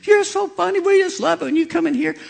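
high, gushing imitation; hammed-up high-pitched delight; delighted receptionist. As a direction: gush at a favourite patient in a high voice. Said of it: "she's just laughing".

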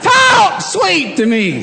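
high, slow, hammed-up wail; mock plea. Preacher hams up a plea for the drive-thru clerk to talk sweet. Begging for kind words.

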